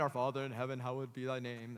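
low, lightly British church recital; a touch of British formality, pitched low. Acted. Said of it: "British voice".

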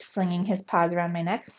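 ordinary delivery, nothing marked

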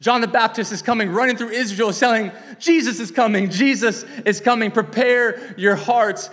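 loud and high, last words slowed; urgent herald cry. Retold or imitated. Preacher crying out that Jesus is coming. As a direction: call out the news, urgent.

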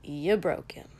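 wry. A narrator's reading. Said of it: "Kotsky said wryly".

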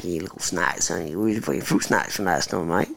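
very low, soft mock Australian; Aussie accent. Riffing to a friend, low mock Australian accent. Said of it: "I do love the accent".

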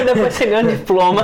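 giggling punchline; laughing joke. Real, not acted. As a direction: crack the joke while laughing. Joke about a gurps degree.

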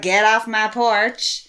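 slow imitation Southern drawl; Southern US accent. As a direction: draw it out in a put-on Southern accent. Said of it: "It starts really southern".

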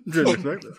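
laughing through the words. Real, not acted. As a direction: giggle it out, can't stop. Laughing at the rat voice.

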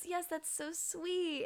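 high, slow, cooing approval; tender mother. Retold or imitated. Mother touched by her daughter's wish, tender. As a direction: draw it out like a touched mom.

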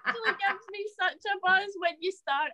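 words bubbling through a high laugh; real giggling through the line. Real, not acted. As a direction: laugh it out, delighted. Laughing at being teased.

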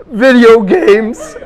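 mock sobbing. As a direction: ham up a boy wailing his confession through tears. Imitating the sobbing boy's answer.

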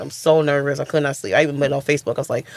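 normal talking voice

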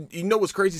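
normal talking voice

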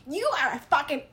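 loud, hammed-up attack on a partner; mock yelling at a partner; angry accusation. Explaining how not to open a talk, mock angry yell. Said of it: "if you go into it and be like".

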